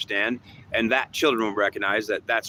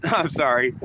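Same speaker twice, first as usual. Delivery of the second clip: ordinary talk, nothing marked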